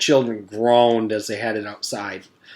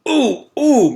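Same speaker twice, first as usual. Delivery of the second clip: put-on high strained "ooh, ooh" like a kid dying to be called on; eager straining groan; 13 semitones higher, 6 dB louder than usual. A narrator's reading. Mac straining to be called on, high eager groan. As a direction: squeal it out, hand stretched high. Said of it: "Mac groaned and looked like he was going to explode".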